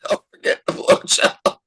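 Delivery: unvoiced whisper of a shout; hushed, breath-only callout; whispered mock yell. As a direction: whisper it like a distant call. Repeats the yelled line, voiceless.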